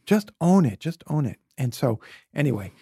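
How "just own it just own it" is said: higher and louder, punchy mock coach; hammed-up pep talk, fired off; friend's advice. Friend urges nervous speaker before talk, punchy mock pep. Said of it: "who was like".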